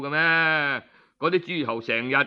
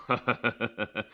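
knowing chuckle; 7 semitones lower, 5 dB quieter than usual. Acted. Character laughs knowingly in story.

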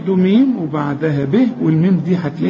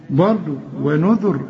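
ordinary talk, nothing marked

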